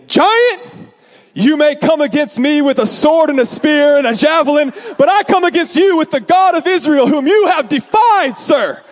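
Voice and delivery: heroic proclamation. Pretend. Driver imagining himself challenging the giant biker, grandly declaimed. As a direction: declaim it like David facing Goliath.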